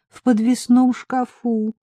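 high, drawn-out, feeble; slowly, as if drained by sickness; weak voice. A narrator's reading. Sick Marika directing her friend to the cupboard, weak voice. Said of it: "ответила она слабым голосом".

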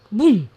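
a sudden punchy boom; big storybook bang; loud boom. Acted. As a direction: crash it out like a falling branch. Branch crashing down.

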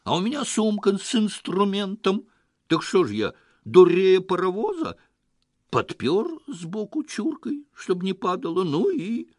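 high, loud agitated tirade; worked-up, high-pitched outburst; spiteful shouting. A narrator's reading. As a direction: shout your defence, voice pitched right up. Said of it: "злобно закричал он".

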